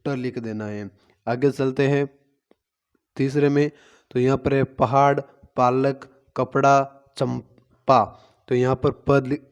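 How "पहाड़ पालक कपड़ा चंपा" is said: very slow, each word stretched; slow, deliberate teacher voice on a word list; slow word reading. Shown as a demonstration. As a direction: say each word slowly for the children to hear the प. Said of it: "दिखाई दे रहा है प वर्ण".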